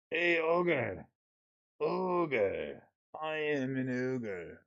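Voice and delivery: slow silly creature voice; mock ogre voice. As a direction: play the ogre for laughs. Playing with ogre card name.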